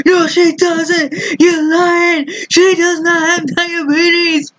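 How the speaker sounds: high, mock-indignant dad voice, a bit slow; imitating an outraged father yelling up the stairs; dad's indignant denial. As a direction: ham up a dad insisting his mom's fine. Dad yells denial from downstairs.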